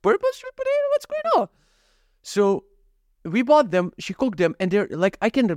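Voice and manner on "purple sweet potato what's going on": squeaky falsetto disbelief; hammed-up astonishment, pitched way up high; mock amazement. Viewer amazed by purple sweet potatoes on a show, mock amazement.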